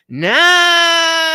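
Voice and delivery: high, loud, drawn-out yell; one sustained, exaggerated high shout; long mock holler. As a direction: holler the word and hold it long. Acting out response to going off course.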